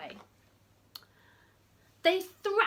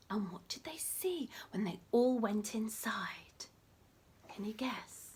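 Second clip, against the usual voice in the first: whisper; 3 semitones lower, 6 dB quieter than usual. Acted.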